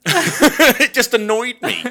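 high, slow laughing complaint; drawn-out laughing gripe; laughing annoyance. Real, not acted. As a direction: laugh out the irritation. Laughing exasperated rant.

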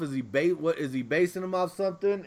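ordinary talk, nothing marked

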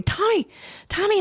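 high-pitched retold call; urgent calling, acted out. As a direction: call to a child through a door. Retelling calls to locked-out child.

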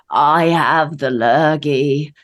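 slow, rough, phlegmy voice with a cold; hoarse voice. Real, not acted. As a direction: croak it out, bunged up and ill. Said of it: "makes me sound ill and like I'm bunged up with phlegm".

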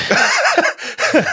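squeaky burst of laughter; high laugh. Real, not acted. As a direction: let out a high giggle.

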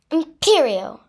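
high, loud incantation; shouted spell. A narrator's reading. Girl casting at Voldemort, shouted. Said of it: "I shouted".

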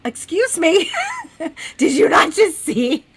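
loud, high-pitched incredulous outburst; shocked disbelief. Retold or imitated. Dreamer to unruffled John about the snake, shocked disbelief. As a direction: re-enact indignant shock, laughing a little. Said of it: "I'm kind of in, like, shock".

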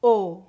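closed vowel O. Shown as a demonstration. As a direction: round the lips tight for O. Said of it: "Pronúncia fechada".

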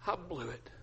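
collapse into a near-silent admission; very soft, defeated drop; acted despair. Peter confessing failure to his wife, despairing. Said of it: "Peter just begins to weep".

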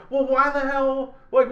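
high, drawn-out mock indignation; indignant questioning. Betrayed apprentice questioning the teacher's motives, indignant questioning.

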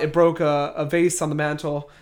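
normal talking voice

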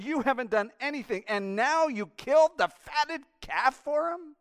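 jealous elder son confronting father; outraged son. Acted. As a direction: shake with indignation. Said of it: "He said".

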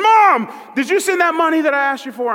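put-on loud drunken phone shout. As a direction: holler it into the phone. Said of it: "loud conversation for all of Panera Bread to hear".

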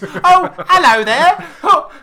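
put-on a startled homeowner cooing at a too-tall child; hammed-up surprised parent. Podcaster voicing a parent who opens the door to Voldemort, hammed-up and cooing.

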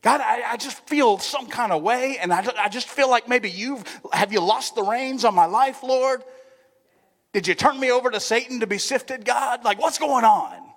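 acted distress, crying out to a father; pleading prayer. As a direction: beg for answers like a lost child. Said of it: "crawl up in his lap and go".

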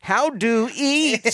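high, very slow alien question. Retold or imitated. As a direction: play a baffled alien learning to eat. Imitating alien's broken speech.

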